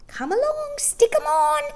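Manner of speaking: very high, loud shrill instructing; high old woman. A narrator's reading. Old shopkeeper telling the children where coins go. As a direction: urge them on.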